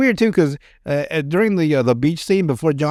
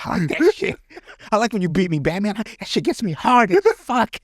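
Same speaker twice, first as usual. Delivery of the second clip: villain taunting Batman, high hammed-up creepy voice; Joker; 5 semitones higher than usual. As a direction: ham up a giggling comic-book madman. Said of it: "look like the Joker".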